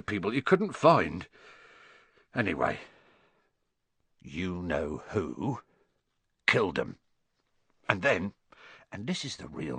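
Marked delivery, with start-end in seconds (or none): 4.2-5.6 slow, hushed, fearful. A narrator's reading. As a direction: whisper the forbidden name slowly. Daring not say the name.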